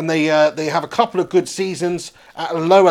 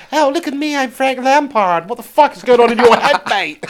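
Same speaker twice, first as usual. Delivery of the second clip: hammed-up brash American accent; 7 semitones higher, 6 dB louder than usual. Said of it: "He wouldn't go".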